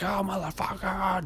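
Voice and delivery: high, drawn-out mock cursing; mock road rage. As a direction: act out a driver's outburst. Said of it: "that point where you're just in your car".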